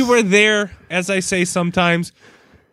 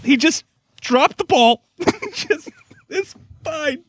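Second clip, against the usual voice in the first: much higher, laughing through it; laughing strained; 13 semitones higher, slower than usual. Real, not acted. Recalling his son's face, high laughing voice.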